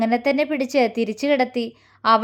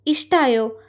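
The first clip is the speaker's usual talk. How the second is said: ordinary delivery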